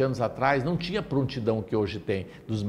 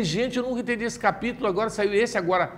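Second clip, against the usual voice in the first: astonished; 8 semitones higher than usual. Retold or imitated. Astonished at the chapter.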